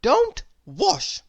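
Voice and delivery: high, bright-eyed know-it-all cry; eager little girl voice. A narrator's reading. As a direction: pitch it way up, keen to answer. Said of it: "cried little Miss Brainy".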